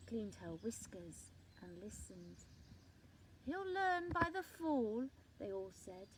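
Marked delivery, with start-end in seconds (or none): 3.4-5.1 knowing animals. A narrator's reading. Animals answer the tree together.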